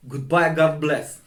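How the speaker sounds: ordinary delivery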